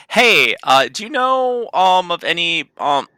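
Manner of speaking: loud, high yell at someone. Acted. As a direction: call out loudly, stumbling over the question. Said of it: "Kay is yelling at the elf".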